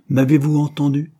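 displeased tone. A narrator's reading. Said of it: "reprit monsieur Ashley d'un ton mécontent".

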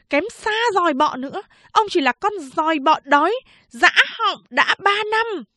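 put-on high, fast, sneering insults from a young woman; a mocking girl cutting him down; scornful woman.

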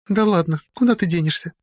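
high, dismissive tipsy voice; drunk. A narrator's reading. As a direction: wave her off, tipsy. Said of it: "пьяно отмахнулась женщина".

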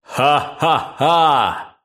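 slow booming laugh; a lightly voiced thunderous laugh. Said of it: "Раздался громоподобный смех".

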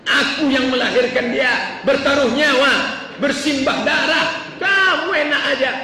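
high, forceful acted protest from an angry wife; raging wife. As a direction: hammer each grievance at the husband. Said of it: "Ngamuk istrinya".

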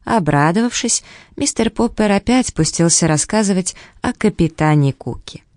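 usual speaking voice

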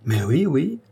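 a touch impatient; mild irritation. A narrator's reading. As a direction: brush off the question with some irritation. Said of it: "fit-il avec une sorte d'irritation".

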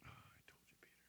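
soft breathy whisper, full of compassion; whispered Jesus. Retold or imitated. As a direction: barely breathe it as a gentle rebuke. Voicing Jesus' silent look.

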